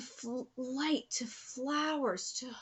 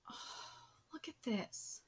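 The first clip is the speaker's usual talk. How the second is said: stunned whisper; 11 dB quieter than usual. Real, not acted. Stunned by a panel.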